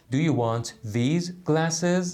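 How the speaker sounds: slow full question. Shown as a demonstration. Teacher slowly reads the full form of the question. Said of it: "کاملش چی بوده؟".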